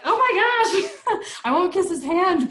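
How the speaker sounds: high, loud, drawn-out gasp of excitement; mock-starstruck squeal of delight; excited. Retelling her own excited reaction.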